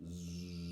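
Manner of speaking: long low buzzing zzz; imitated wasp buzz. Dad mimicking wasps at the picnic, soft buzzing.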